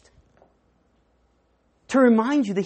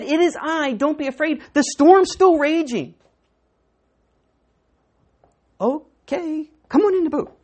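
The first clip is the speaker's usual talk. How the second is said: loud and high, building to a shout; climactic preaching; 8 semitones higher, 9 dB louder than usual. Acted. Preacher calling Jesus into the boat, loud and high. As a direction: preach it at full force.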